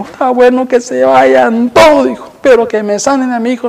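high, pleading mock desperation; high-pitched imitated plea for his son; desperate pharaoh. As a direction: beg as the broken king. Pharaoh begging after losing son.

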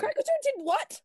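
high-pitched, incredulous outburst; stunned question, pitch leaping way up; shocked disbelief. Acted. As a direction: blurt it out in shrieky astonishment. Reacting to news of punched cop.

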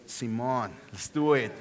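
Simón as see-MOHN, stress on the last syllable; Simón said the Mexican way. Shown as a demonstration. Pastor shows the congregation how to say Simón, low and easy. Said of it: "you have to say it like that".

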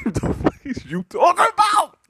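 loud, high laughing yell of disbelief; incredulous shout. Real, not acted. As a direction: shout it through a laugh, can't believe it.

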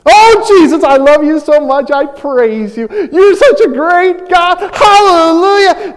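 high, loud, over-the-top gushing praise; gushing worshipper. Pretend. Preacher parodies a gushing churchgoer praising Jesus, high and loud. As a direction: ham up a fawning believer crying hallelujah.

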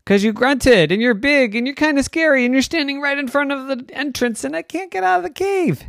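high, loud, rushing complaint; agitated story girl. A narrator's reading. Maven blocked in the cave by a bear, high agitated outburst.

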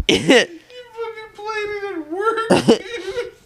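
very high, wheezed through real laughter; cracking up in disbelief; helpless laughing. Real, not acted. Cracking up at song played at work.

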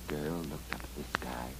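plain reading voice

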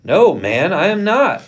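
high, loud denial; sharp retort. A narrator's reading. Peter denying he is one of them, retorting. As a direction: throw the accusation back, voice jumping up. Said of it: "Peter retorted".

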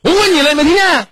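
very high, loud mock yell; imitated angry yelling. Counsellor imitates mother scolding her silent child, yelling. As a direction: shriek the questions in imitation of a parent. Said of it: "我继续学你".

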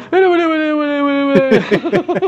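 high giggly laugh; high burst of laughter. Real, not acted. Laughing at cringe nickname joke.

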